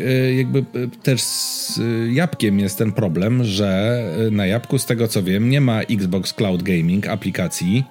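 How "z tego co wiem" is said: ordinary talk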